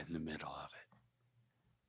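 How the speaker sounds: soft slow whisper. Real, not acted. Daughter whispers a tip to dad. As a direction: breathe the words out slowly. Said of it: "whispered instruction".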